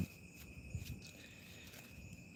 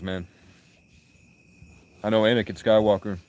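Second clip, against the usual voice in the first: ordinary delivery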